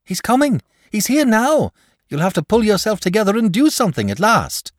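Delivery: put-on high, loud urgent boy's voice; excited boy. A narrator's reading. Boy urges the dragon to act, high and loud. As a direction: urge the lazy dragon to act.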